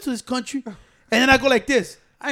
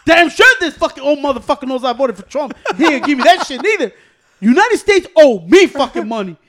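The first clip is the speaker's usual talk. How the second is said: loud, raised-pitch mock complaint; comic indignant rant; 5 semitones higher, 12 dB louder than usual. Ranting to friends about a missing stimulus, loud mock indignation. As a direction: belt out the grievance for laughs.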